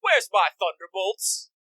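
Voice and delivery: slow, high "Honey" call; lazy god. Retold or imitated. Zeus calling to his wife, a slow mock-casual ask. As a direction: ask the wife for weapons, drawn out.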